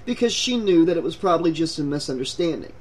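plain reading voice

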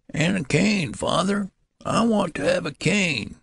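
put-on very high old-man voice; demanding old man. A narrator's reading. Old newborn calling down hall to father, demanding.

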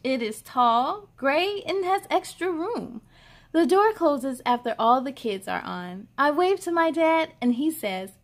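put-on bright, lively read-aloud for kids; cheerful storybook reading; story narration. A narrator's reading. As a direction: read it like a picture book to little ones. Reads picture book aloud.

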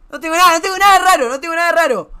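high, fast, loud protest; quick defensive repetition; protesting insistence. Real, not acted. Insists nothing odd in gallery.

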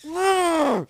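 drawn-out high, loud shriek; real full-volume scream of fright; long scream. Real, not acted. Player scared after cursing, long terrified scream.

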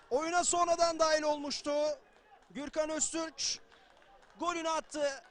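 loud, energetic play-by-play; live football commentary. Real, not acted. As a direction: keep the post-goal energy up. Energetic play-by-play after goal.

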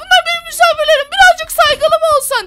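indignant scolding. Acted. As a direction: scold him for rudeness. Scolding Gürol in front of guests.

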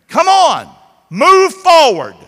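high, loud, slow call to move; rally them, loud and stretched; preaching shout. Real, not acted. Preacher urging the church forward, shouting.

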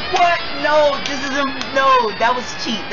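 high, loud protest; loud incredulous objection; outraged disbelief. Real, not acted. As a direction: cry out against an unfair result. Protesting a lost point.

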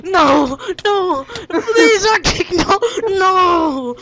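hammed-up wailing plea, high and loud; loud, tearful play-acted begging; desperate begging. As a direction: cry out in mock despair, voice climbing high. Acted begging as Arctic falls.

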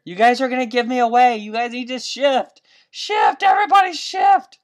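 high, loud, pleading yell; frantic yelling. Real, not acted. Gamer yelling at other players to shift away. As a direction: shout at them to move away. Players crowding her spot.

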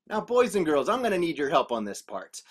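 plain reading voice

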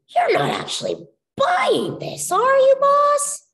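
high, slow incredulous squeak; disbelieving rabbit. A narrator's reading. Chuck doubting his boss, high and incredulous. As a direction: sound like you can't believe your boss.

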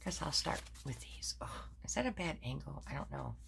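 hushed self-talk while fixing the shot; whispered aside. Real, not acted. To herself while checking the camera angle, whispered.

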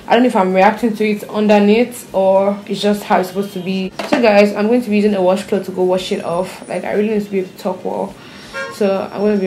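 lips barely moving; talking with stiff face mask on. Real, not acted. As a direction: tight restricted mouth movement. Seen 1: Thick dried paste covers face and lips; washed off by +17.

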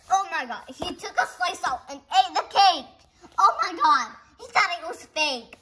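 high, loud gushing outbursts; excited exclaiming. Real, not acted. Kid thrilled the frog ate the cake. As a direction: exclaim each discovery with glee.

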